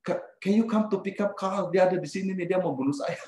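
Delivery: high, urgent begging; imitated frightened plea. Retelling begging Steve for help against Carl, frightened plea. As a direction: plead for rescue as if in danger. Pleading phone call for rescue.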